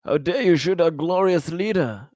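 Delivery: ordinary delivery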